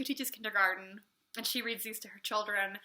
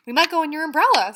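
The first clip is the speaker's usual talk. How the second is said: Pooh repeating himself; 5 semitones higher, 13 dB louder, faster than usual. Acted. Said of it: "said Pooh".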